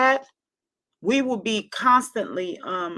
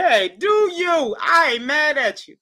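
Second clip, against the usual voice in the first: laughing; 6 semitones higher, 4 dB louder, slower than usual. Real, not acted. Still laughing.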